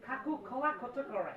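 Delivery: কাকু খোলা খচ করে with ক said as kh, খোলা for কলা, খচ for কত; foreigner's Bengali accent. Retold or imitated. Teacher mimicking a foreign tourist buying bananas, kh accent. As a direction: mimic a foreigner who can't say ক. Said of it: "ও বলে".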